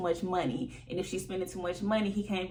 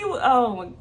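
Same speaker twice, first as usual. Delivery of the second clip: ordinary delivery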